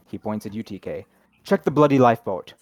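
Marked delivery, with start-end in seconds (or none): 1.4-2.5 loud barked order over the wind; captain roaring an order at the crew. Acted. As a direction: bellow it like a man possessed. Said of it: "roars like a man possessed".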